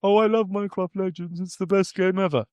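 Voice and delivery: high, mock-adoring fan voice; sarcastic fan gushing. Mock fan quote, "Said no one.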